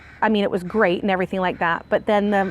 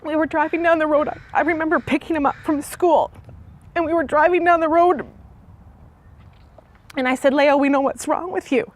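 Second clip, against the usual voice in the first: high, teary voice close to crying; telling it through tears; tearful; 5 semitones higher than usual. Real, not acted. Mother telling how she told Leo, teary and high. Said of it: "it just gets me every time".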